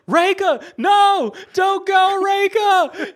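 high, very loud imitation of a desperate plea; super dramatic shouting. Father calling after his daughter in a restaurant, super dramatic shout.

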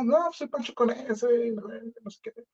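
a lightly mocking rapper intro; cocky self-intro. Mocking his own on-air intro.

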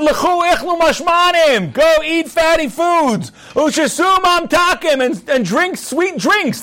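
high, slow, booming proclamation; hammed-up leader calling out to the feast; Nehemiah's proclamation. Nehemiah booms at the crowd to go feast, hammed-up proclamation. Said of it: "He said".

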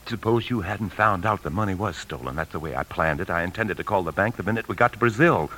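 ordinary delivery

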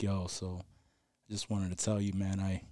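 low, very soft imagined confession; serious confiding. Acted. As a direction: murmur it like a friend opening up. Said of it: "get too serious".

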